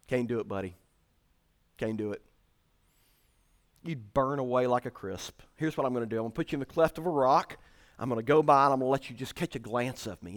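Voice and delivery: low, quiet, matter-of-fact refusal to a buddy; low, casual God. Retold or imitated. God gently turning down Moses' request, low and quiet. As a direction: voice God softly, low and laid-back. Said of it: "what God told Moses".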